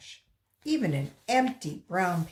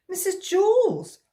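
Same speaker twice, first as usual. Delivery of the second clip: high, loud call across the room; boy calling to his teacher about his lunch; 12 semitones higher, 10 dB louder than usual. Acted. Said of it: "he called".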